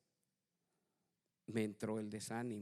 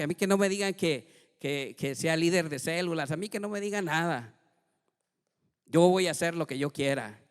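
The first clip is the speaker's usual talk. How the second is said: loud, higher, angry refusal; defiant outburst; 5 semitones higher, 9 dB louder than usual. Retold or imitated. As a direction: shout it like a rebel fed up with church. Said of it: "y yo decía".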